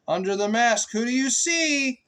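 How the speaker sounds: rising, questioning refrain; sing-song refrain. A narrator's reading. Asking the child the book's refrain, sing-song.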